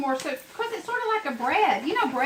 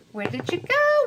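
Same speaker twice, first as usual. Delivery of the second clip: higher, lilting question to a lost piece; puzzled search; 10 semitones higher than usual. Real, not acted. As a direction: ask the runaway potato where it went. Hunting for a dropped potato.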